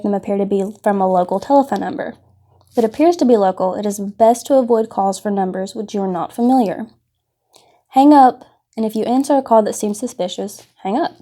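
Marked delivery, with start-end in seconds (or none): none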